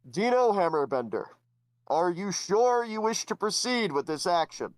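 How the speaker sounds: the head god asks the hero to confirm; booming head god. Acted. Head god questioning Dino, grand and formal.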